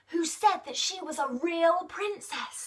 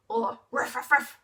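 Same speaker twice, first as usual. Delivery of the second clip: ordinary delivery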